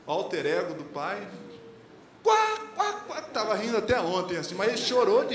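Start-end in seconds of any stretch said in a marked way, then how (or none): 2.2-2.9 high, loud cartoon cackle of a laughing kid; mock the boy's laugh with a high quack; imitated laughter. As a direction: pastor mimicking his son's high laughter.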